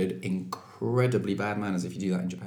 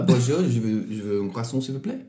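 mock French. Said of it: "that's how I ask for it in, um, in Pret".

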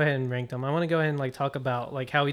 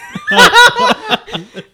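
big burst of laughter; loud laugh; 17 dB louder than usual. Real, not acted. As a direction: crack up loudly.

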